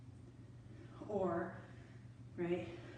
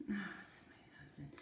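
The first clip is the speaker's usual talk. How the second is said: whisper; 2 semitones lower, 11 dB quieter than usual. Real, not acted. Husband texting during workout.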